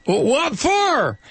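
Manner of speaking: high, slow puzzled country voice; incredulous local. Retold or imitated. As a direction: stretch it out, baffled. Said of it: "I have to do this occasionally".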